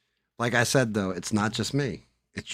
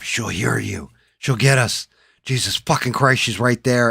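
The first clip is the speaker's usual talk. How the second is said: panicked; 5 semitones higher, 9 dB louder than usual. Retold or imitated. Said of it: "panicked hiding from alien queen".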